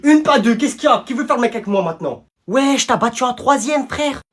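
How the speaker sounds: ordinary talk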